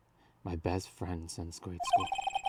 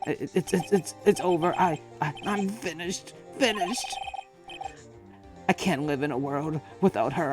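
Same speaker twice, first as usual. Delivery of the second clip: much higher, loud sobbing plea; hysterical grief; 10 semitones higher, 4 dB louder than usual. Acted. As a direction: break down, begging for answers. Larry grieving hysterically.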